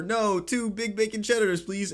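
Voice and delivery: yelling over. Retold or imitated. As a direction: call it over the driver. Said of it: "and I yell over".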